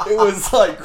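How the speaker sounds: loud, high, laughing through the words; real laughter. Real, not acted. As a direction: struggle to tell it through laughter. Laughing while telling the story.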